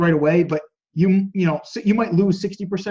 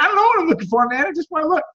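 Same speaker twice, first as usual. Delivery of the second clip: much higher, fed-up customer whine; mock exasperation, pitched way up; exasperated shopper; 12 semitones higher, faster than usual. Shopper begging a pushy clerk to leave him alone, exasperated.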